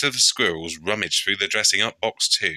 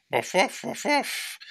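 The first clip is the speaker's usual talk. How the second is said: high yappy puppy barks; dog barking; 13 semitones higher than usual. Acted. Dougie showing off his badge, high dog barks. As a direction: bark proudly like a little dog.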